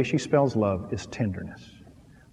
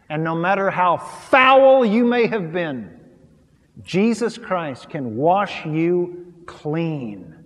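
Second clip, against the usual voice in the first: slow, louder and higher altar call; slow earnest preaching; 6 semitones higher, 7 dB louder, slower than usual. Acted. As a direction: plead with them slowly to come to Jesus. Closing appeal of sermon.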